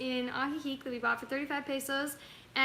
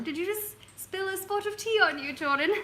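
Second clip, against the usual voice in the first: put-on British accent; 2 semitones higher than usual. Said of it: "to talk in a British accent".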